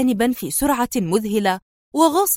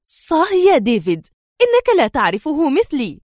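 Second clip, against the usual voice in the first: higher-pitched, sharp interruption; 5 semitones higher, 3 dB louder than usual. A narrator's reading. Sonia interrupting David, sharp and impatient. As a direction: cut him off sharply. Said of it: "قاطعته في حدة".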